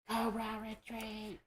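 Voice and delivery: high raspy villain order; Cobra Commander voice. Retold or imitated. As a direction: hiss the retreat order high and thin. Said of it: "that's what he says".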